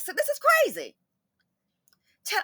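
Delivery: ordinary talk, nothing marked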